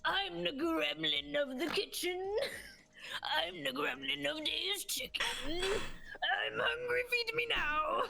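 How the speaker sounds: hungry gremlin, climbing high at the end; slow gremlin chant rising to a high demand. Acted. Guest as gremlin of the kitchen demanding food, in gremlin voice. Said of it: "I'll do a gremlin voice".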